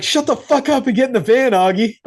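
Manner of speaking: exasperated order. Retold or imitated. Will ordering Augie into the van, mock exasperated. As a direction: order him about, pitched well up.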